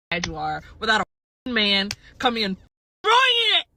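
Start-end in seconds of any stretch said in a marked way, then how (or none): 3.0-3.7 loud, high, drawn outburst; exasperated yell. Real, not acted. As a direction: burst out high and loud, fed up. Fed up with men interrupting.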